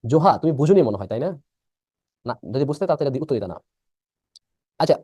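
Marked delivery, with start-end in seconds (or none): none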